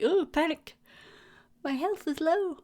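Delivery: high, hammed-up alarm; mock panic. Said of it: "I'm like".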